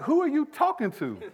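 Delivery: high, loud mock outrage; indignant parent. Parent answering a demanding two-year-old, comically indignant. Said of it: "You kinda get indignant".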